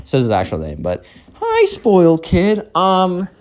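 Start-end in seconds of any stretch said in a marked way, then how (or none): 1.4-3.3 high, slow, hesitant greeting; polite mom voice. Acted. Mom greets her son's strange guest, politely awkward. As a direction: greet slowly in a high, careful voice.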